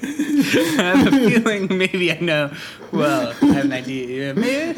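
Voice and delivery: loud, high, laughing speech; cracking up while trying to get words out. Real, not acted. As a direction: talk through a fit of laughter. Cracking up at co-host's awkward answer.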